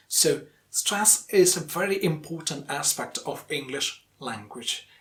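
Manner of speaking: ordinary talk, nothing marked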